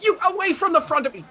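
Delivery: cranky stall-keeper ordering someone off; indignant old shopkeeper. Acted. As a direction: shoo them away as an irate old fellow. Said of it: "at which point the old fellow".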